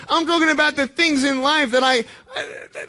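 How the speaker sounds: shouting then a breaking sob. Real, not acted. Speaker to the school about his pain, voice cracking into a sob. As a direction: push through until the voice cracks.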